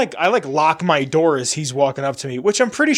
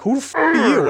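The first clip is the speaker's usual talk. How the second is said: high-pitched mock outraged challenge; retold yelling; 10 semitones higher than usual. Driver challenging a stranger yelling at them, retold yelling. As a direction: re-enact yelling back, voice pitched way up. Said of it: "I just start yelling at him".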